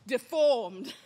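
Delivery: usual speaking voice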